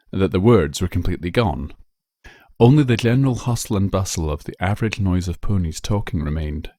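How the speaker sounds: usual speaking voice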